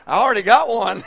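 laughing through a retold refusal; laughing bride and groom. Couple at the altar refusing vows, laughing. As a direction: giggle it out mid-vow. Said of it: "both looked at me".

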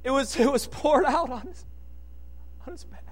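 ordinary talk

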